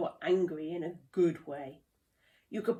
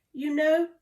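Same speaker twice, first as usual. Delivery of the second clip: suggesting; 7 semitones higher, 7 dB louder than usual. A narrator's reading. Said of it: "suggested Lasimba".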